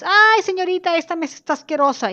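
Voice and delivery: high, loud, whiny customer protest; fussy complaint. Retold or imitated. Customer complaining to the waitress, high whiny protest. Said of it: "Y te quejas".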